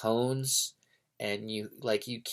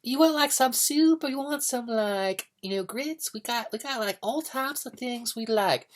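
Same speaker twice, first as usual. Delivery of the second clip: very high, gushing waitress voice; American waitress; 3 dB louder than usual. Acted. American waitress coming up to a customer, over-friendly. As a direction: greet the diner in a high-pitched, effusive singsong. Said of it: "American waitress will come up to you like".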